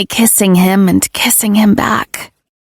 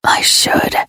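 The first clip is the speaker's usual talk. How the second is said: breathy low rasp; 3 dB quieter than usual. Acted. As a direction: rasp it, lips almost touching. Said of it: "he rasps".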